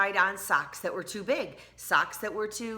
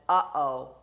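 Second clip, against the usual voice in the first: lower, louder mock worry; a knowing parental uh-oh; mother foreseeing smelly socks, worried; 4 semitones lower, 6 dB louder than usual. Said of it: "Tina's mother said".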